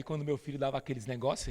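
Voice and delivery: normal talking voice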